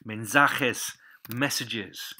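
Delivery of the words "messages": ordinary talk, nothing marked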